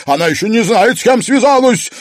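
high, loud furious yell; enraged threat; furious shout. A narrator's reading. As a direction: shout while held back. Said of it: "кричала Марьяна".

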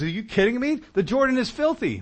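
high-pitched indignant outburst, retold; irate. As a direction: act out an offended man's disbelief, pitched high. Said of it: "he gets really irate".

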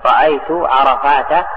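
عرفات ending in "-ta", no final n; slow demo, say عرفات as "arafaata"; fatha ending. Shown as a demonstration. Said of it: "ويجر أيضًا بالفتح". (عرفات بالفتح)

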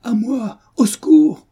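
appel au secours très aigu et fort; cri au secours. A narrator's reading. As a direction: crier à l'aide, de toute sa voix. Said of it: "se mit à crier". (cri de détresse perçant)

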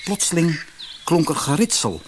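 ordinary delivery, nothing marked

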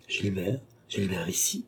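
hushed, softly voiced surprise; soft questioning rise, taken aback; surprised exclamation. A narrator's reading. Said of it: "s'écria André avec une surprise".

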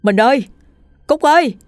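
high, loud calls with a catch in the throat; choked calling out. A narrator's reading. Father searching the forest for his wife and child, calling high and loud. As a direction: shout their names across the forest, desperate. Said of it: "những tiếng hô vang nghẹn ngào".

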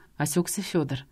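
ordinary delivery, nothing marked

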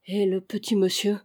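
usual speaking voice

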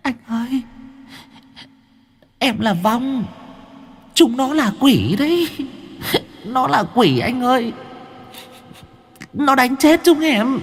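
high, whiny near-tears pleading; whimpering ghost. A narrator's reading. The student ghost whimpers to Hải Nghẹo in a high voice. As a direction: whimper like a frightened child ghost. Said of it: "Con vong sinh viên mếu máo".

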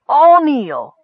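high, loud royal command; regal demand. A narrator's reading. Gorge commanding his subjects to kneel, regally demanding. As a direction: order your subjects with pompous force. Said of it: "Gorge demanded regally".

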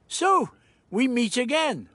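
high, theatrical greeting voice; Doctor's jovial greeting. Acted. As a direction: greet captors with showy cheer. Doctor greets his captors.

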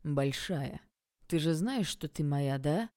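a hint of menace, voice kept low; lightly voiced jealous ex pressing her; low threatening voice. A narrator's reading. Jealous ex claims the heroine, low mild menace. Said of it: "В низком голосе Кайрата чувствовалась угроза".

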